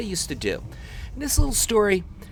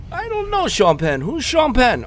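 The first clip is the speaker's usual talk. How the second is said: very high, loud mock German tourist; hammed-up German accent, baffled; 11 semitones higher, 6 dB louder than usual. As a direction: imitate a clueless German friend, pitched way up. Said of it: "my two German friends".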